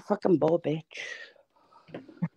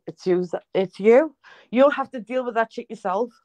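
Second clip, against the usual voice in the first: usual speaking voice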